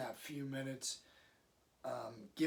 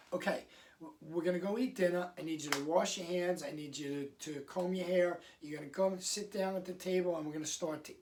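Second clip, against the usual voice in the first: rushed over-explaining; faster than usual. Shown as a demonstration. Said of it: "too much information for them".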